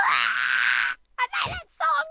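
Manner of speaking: squealing with delight; thrilled fangirl cry; awkward joy. Retold or imitated. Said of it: "I was like".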